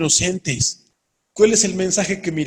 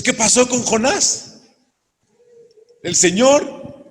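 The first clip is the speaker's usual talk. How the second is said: normal talking voice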